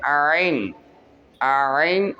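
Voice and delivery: आइन slowly from the throat, long vowel; slow deep आइन; throaty आइन. Shown as a demonstration. Teacher models Arabic ain for students, slow throaty. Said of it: "हलक में से अदा होगा".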